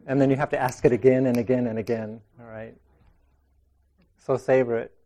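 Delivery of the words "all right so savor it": usual speaking voice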